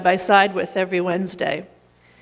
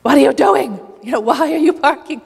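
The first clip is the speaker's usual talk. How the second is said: higher-pitched, mildly exasperated retelling; mock exasperated demand, pitched up; frustrated; 5 semitones higher than usual. Imitating an angry neighbour confronting church staff over parking, frustrated. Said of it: "is frustrated and says".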